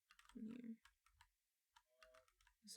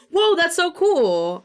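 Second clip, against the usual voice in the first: mock wow; 9 semitones higher, 7 dB louder, slower than usual. Mock impressed reply to self.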